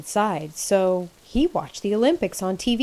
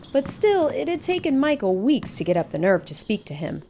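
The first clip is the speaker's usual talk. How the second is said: ordinary delivery, nothing marked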